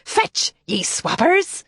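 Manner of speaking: rough pirate command to the dogs; pirate brogue. Acted. Twin tossing sticks to the dogs, still a pirate. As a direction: bark the order like a ship's captain. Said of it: "she told the dogs".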